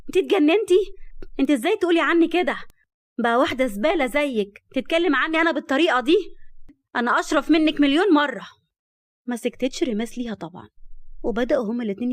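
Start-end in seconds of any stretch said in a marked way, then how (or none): 0.1-8.5 loud, higher-pitched outrage; furious quarrel. Acted. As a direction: hurl it at a rival, offended and loud. Heroine insults rival in quarrel.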